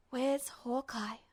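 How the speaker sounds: ordinary delivery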